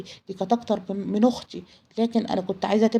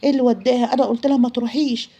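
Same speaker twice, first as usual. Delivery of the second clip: higher, louder agitated questioning; frantic disbelief; 4 semitones higher, 4 dB louder than usual. Retold or imitated. Friend panicking that she went to the flat, frantic. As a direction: raise pitch and volume in alarmed disbelief. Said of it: "وبقيت أنا زي المجنونة".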